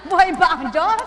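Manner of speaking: loud call through laughter; rally them while laughing; laughing shout. Real, not acted. (sigaw na may tawa)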